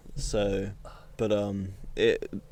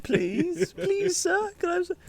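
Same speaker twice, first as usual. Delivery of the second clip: put-on squeaky begging kid; slower than usual. Mock begging for cigars.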